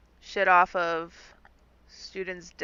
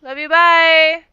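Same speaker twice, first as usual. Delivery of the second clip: breezy goodbye; 8 semitones higher, 13 dB louder than usual. Acted. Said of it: "she's gonna be like".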